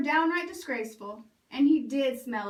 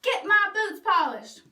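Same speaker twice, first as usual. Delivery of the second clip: louder, higher bossy demand; yelled order; 6 semitones higher, 5 dB louder than usual. Acted. Other stepbrother demanding shined boots, loud yell. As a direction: fling the order at him.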